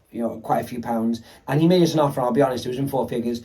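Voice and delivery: ordinary talk, nothing marked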